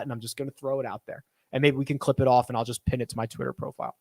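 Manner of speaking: ordinary talk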